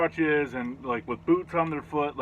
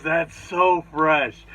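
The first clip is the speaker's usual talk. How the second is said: louder, drawn-out retold delight; geeking out; 2 semitones higher, 6 dB louder, much slower than usual. Jay geeking over the disc, retold excitement. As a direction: stretch it out, imitating awed excitement. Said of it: "he started geeking over this thing".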